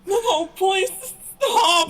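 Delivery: high loud crying plea; being tickled. Acted. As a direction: beg through laughter. Character tickled back.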